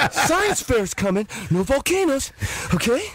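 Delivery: high, breathless school announcements; winded actor reading the morning announcements; Mark Wahlberg. Acted. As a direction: pitch it up, as if out of breath. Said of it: "He's doing high school announcements".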